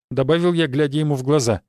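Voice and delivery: ordinary delivery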